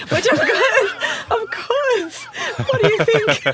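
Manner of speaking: giggling through the words; laughing. Real, not acted. Caught doing the Aussie accent, laughing out loud.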